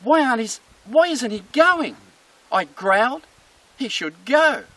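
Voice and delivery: high, loud baffled protest; indignant dog. Acted. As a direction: protest as a dog whose growl failed. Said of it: "And the dog's going".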